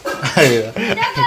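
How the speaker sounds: bursts out laughing, caught out; a helpless guilty laugh after the prank. Real, not acted. Husband laughing at wife's balm reaction, guilty laugh.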